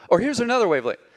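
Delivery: ordinary delivery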